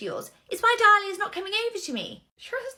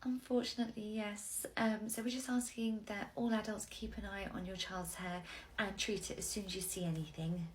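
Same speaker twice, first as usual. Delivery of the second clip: ordinary delivery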